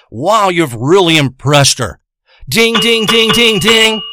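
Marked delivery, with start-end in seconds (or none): 2.5-4.0 mock bell ding. Mimics a bell going off.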